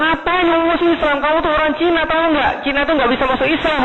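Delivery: high-pitched mock outrage; imitasi orang tua mengamuk. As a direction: scold like angry parents, pitched well up. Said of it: "Ngamuk mereka". (orang tua marah, ditirukan dengan nada tinggi)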